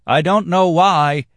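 cry out. Acted. Dancer heckles the table, high and loud. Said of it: "cried the dancer again".

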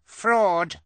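put-on loud, high baby shout; cry of Fraud. Baby Sunny shouting at the fake fortune teller. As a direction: shout it out accusingly. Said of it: "Sunny cried".

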